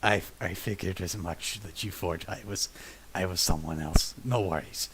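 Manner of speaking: very low, soft ghostly voice; Sevatar. Retold or imitated. Ghost character answers a player's apology, very low and quiet. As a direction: answer deep and quiet, coolly letting it go. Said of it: "just in Sevatar's ghost voice go".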